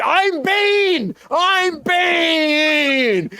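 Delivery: high, drawn-out mock shout; hammed-up villain battle cry, stretched out; Bane. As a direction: bellow it like a rampaging villain, mockingly. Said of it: "And he's like".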